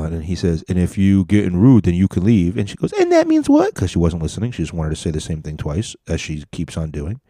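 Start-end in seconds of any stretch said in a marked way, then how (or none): 2.9-3.7 very high, mocking falsetto; her voice. Woman talks back to gym worker, mock falsetto. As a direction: imitate her in an exaggerated squeaky voice. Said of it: "her voice in this voice".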